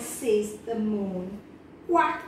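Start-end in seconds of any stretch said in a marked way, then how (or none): 1.9-2.2 louder quack; a loud, firm duck quack; mother duck quack. Acted. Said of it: "says the mother duck".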